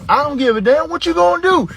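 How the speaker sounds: high, indignant comeback; worked-up dad squaring off; defiant challenge. Retold or imitated. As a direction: dare the kid, pitch shooting up. Challenging threatening daughter.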